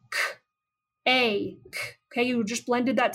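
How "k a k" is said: k, a, k said one by one; segmented sounds. Shown as a demonstration. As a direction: hold each sound of cake apart. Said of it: "let's move on to the second one".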